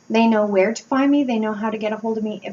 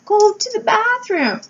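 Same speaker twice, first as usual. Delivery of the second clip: high, slow, mock-crying child's yell; imitating a child screaming, much higher; 11 semitones higher, slower than usual. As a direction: wail it like a sick kid calling for mom. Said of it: "You were screaming".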